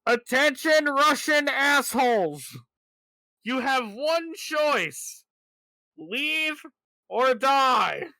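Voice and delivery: high, slow, loud announcer voice; hammed-up menacing megaphone warning; loudspeaker threat. Warning invading Russians over the tank mic, high and slow. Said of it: "I'd basically be like".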